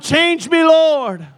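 high, loud shout of "Change me, Lord"; shouted prayer. Shown as a demonstration. Pastor shouting the cry for the congregation to copy, loud. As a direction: belt "Change me" out like a plea to heaven. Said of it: "somebody shout and shout".

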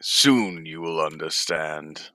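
emperor. Acted. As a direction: intone it like a cryptic ruler. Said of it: "but you're the emperor".

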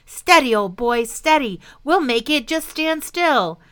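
firm, calming call; steady him like a frightened animal; reassuring. Acted. Father calming the dragon, reassuring.

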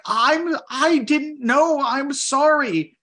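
high, slow, voice close to tears; tearful apology. Retold or imitated. Young worker apologizing to the manager, high and tearful. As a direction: plead through tears, drawn out. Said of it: "like, in tears".

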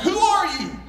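high echoed call; mimicked call coming back from afar. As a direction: imitate an echo of a high call. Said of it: "this voice came back".

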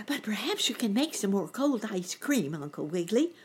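put-on mildly hopeful boy-bunny request. A narrator's reading. Boy bunny asking uncle for ice cream, mildly hopeful. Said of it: "sort of hopeful-like".

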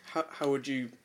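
talking round a pen in the mouth; words muffled by a pen held in the mouth; pen in teeth. Real, not acted. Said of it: "asking a good question with, with a pen in my mouth".

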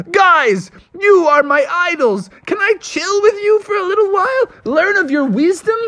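very high, fast, gushing; fanboy meeting his heroes; starstruck fan. Acted. Owner gushes to his idols the founders, high and fast.

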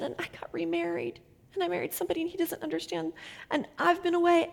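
high, hushed tearful confession, retold; soft voice lifted high, close to tears; crying. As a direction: relay her weeping admission, half-whispered. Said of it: "she's crying and crying".

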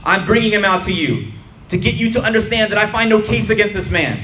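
Pilate addressing a crowd. A narrator's reading. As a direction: call out to the crowd. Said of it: "said to the crowd".

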